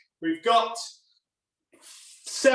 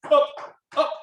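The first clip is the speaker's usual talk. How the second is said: barking gym coach; 7 semitones higher, 4 dB louder than usual. Real, not acted. Workout coach urging knees up.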